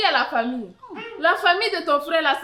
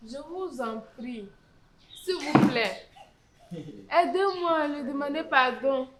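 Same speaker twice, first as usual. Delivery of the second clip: soft, lowered, slow entreaty; humble pleading; 3 semitones lower, 5 dB quieter than usual. Acted. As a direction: beg the elder gently for help. Widow begs chief to help apologise. (supplication humble, voix basse et douce)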